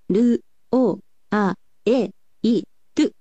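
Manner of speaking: "ル、お、あ、え、い、トゥ" sounded out one by one, each vowel gliding into the next; sliding vowel demo. Shown as a demonstration. Teacher shows how the vowels in "light" slide, sounding out each one. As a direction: spell out "ル、お、あ、え、い、トゥ" slowly as a chain of gliding vowels. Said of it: "のようにスライディングをします".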